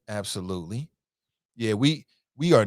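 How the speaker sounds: ordinary talk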